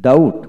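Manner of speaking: ডাউট said with no b sound; doubt pronunciation. Shown as a demonstration. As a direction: say ডাউট, straight from "au" to t. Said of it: "বি উচ্চারিত হবে".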